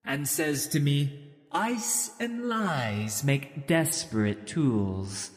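eerie witch prophecy. Acted. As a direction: intone it like a distant witch. Ice Witch's prophecy continues.

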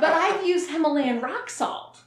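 laughing through the words. Real, not acted. Laughing confession of salt use.

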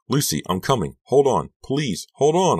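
frantic, loud encouragement; calling out. A narrator's reading. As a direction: call to her as you rush over. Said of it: "I called".

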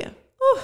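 high, sharp exhaled whew; overwhelmed whew. Real, not acted. Mother steadying herself mid-tribute, overwhelmed exhale. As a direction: blow out a breath to hold back tears. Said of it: "gonna have to take a moment".

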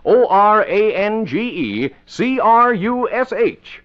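O R A N G E C R U S H spelled letter by letter. Shown as a demonstration. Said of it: "That's Orange Crush".

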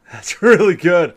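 delighted laugh through the words. Real, not acted. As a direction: laugh it out, loving the taste. First taste of beer.

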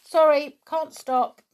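loud high call over the shoulder; a boy calling back as he hurries on. A narrator's reading. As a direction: shout back without stopping. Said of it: "called back Julian".

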